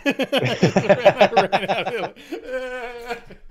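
very slow, high, broken with laughter; half-laughing half-crying; words barely out through laughter. Real, not acted. Laughing while retelling running out.

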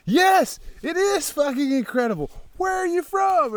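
high, loud, gushing reply; imitating overjoyed travelers; thrilled strangers.

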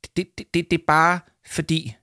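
nervous stutter climbing high; flustered, stuttering excuse; stammering. A narrator's reading. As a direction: trip over the first word before getting it out. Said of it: "stammede Arthur".

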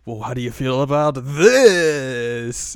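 slow, loud theatrical flourish; voiced Yoshiko. Yoshiko as fortune teller unveiling the music, slow and showy. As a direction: build to a big drawn-out reveal.

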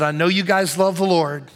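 ordinary talk

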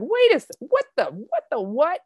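high, fast mock double take; baffled. Scanning the verse, she mocks her own high surprise. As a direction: squeak out your confusion. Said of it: "I was like".

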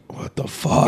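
imitating a stunned whisper, barely voiced; hushed disbelief. Retelling Joaquin Phoenix's reaction at the crying dinner table, hushed disbelief.

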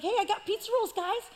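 put-on high, eager kid voice; lightly goofy teen voice; naive young David. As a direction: chirp it like an innocent delivery boy. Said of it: "he's like".

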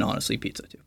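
soft, voiceless aside; whispered aside. Real, not acted. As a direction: breathe it out as a secret. Aside joke about pizza.

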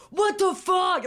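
high loud mock yell; angry outburst. Retelling the rejected boyfriend's furious reaction, loud mock yell. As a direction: explode in disbelief.

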